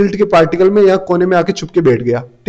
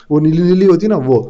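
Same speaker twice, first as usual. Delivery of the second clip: ordinary delivery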